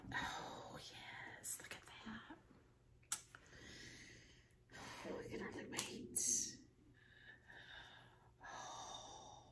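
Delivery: slow, hushed whisper of delight; breathless marvelling whisper; awed whisper. Real, not acted. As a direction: whisper it like it might vanish. Marvelling at new palette.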